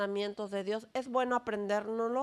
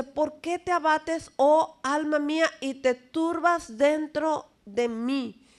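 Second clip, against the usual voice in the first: slow, raised reading of the psalm; slow solemn psalm reading; scripture reading; 4 semitones higher, 3 dB louder, slower than usual. Shown as a demonstration. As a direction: read the verse slowly and earnestly, a little higher. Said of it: "Salmo cuarenta y dos cinco dice".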